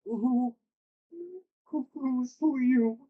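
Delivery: timid elf. Acted. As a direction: stammer it out like a scared weeping elf. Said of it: "And he goes".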